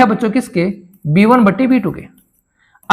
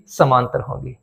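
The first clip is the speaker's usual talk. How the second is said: quiet class answer; 8 semitones lower, 7 dB quieter than usual. Pretend. Teacher voices class answer.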